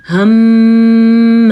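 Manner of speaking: ہم held loud with ringing nasal hum; ghunna demo. Shown as a demonstration. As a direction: hum the م long through the nose. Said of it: "اس میں گونج پیدا ہوتی ہے".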